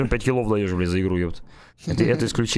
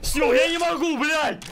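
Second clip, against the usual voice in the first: furious shout; 14 semitones higher, slower than usual. Real, not acted. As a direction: yell at your teammate. Yelling at teammate after dying.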